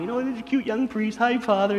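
high, sweet voice of flattering church ladies; cooing ladies. Retold or imitated. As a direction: coo like admiring old ladies.

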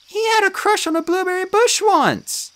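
miserably. A narrator's reading. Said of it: "Juniper said miserably".

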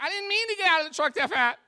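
high-pitched, hammed-up pleading; panicked protest. Driver to cop with drawn gun, panicked protest. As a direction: plead your innocence in comic panic.

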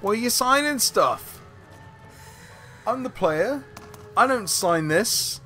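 high, outraged player protest; indignant protest. Real, not acted. Protesting the game signing.

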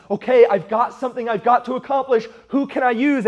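lightly voiced, emphatic call for volunteers; God. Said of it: "when God says".

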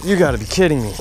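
normal talking voice

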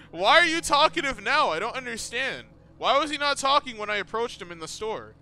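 exasperated, high-pitched demand for an explanation; baffled outburst. Real, not acted. Confronted by puzzling talkative character.

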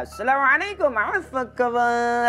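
drawn-out announcer greeting; Wan Hussein voice. Retold or imitated. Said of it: "Suaranya gimana".

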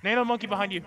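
sharp warning shout; urgent callout. Real, not acted. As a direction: yell the warning fast. Warning callout mid-fight.

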